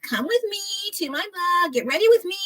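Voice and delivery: high, breathy-free sing-song influencer imitation; mock a vlogger inviting followers along. Imitating female influencers inviting viewers, high influencer voice. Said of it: "they have this voice, like".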